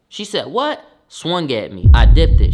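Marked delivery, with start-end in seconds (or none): 0.5-0.8 high, sharp outraged what. Retold or imitated. Grandma outraged at his backtalk, high indignant what. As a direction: fire back in disbelief.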